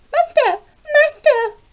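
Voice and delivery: very high, loud calling voice; harp calls out. A narrator's reading. Stolen harp calls for its master, high cry. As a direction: cry for help in a high magical voice. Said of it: "the harp continued to cry".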